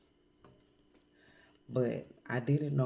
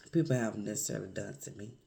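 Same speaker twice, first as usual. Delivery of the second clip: ordinary talk, nothing marked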